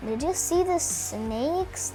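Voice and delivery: ordinary delivery, nothing marked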